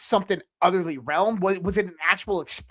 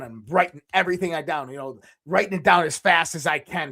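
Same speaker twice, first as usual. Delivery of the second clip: usual speaking voice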